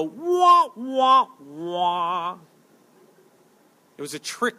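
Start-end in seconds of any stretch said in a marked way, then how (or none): 0.0-2.4 descending cartoon cry of disappointment; mock wail of defeat. Preacher mocking the beaten Pharisees, exaggerated sinking wah-wah. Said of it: "And the Pharisees go".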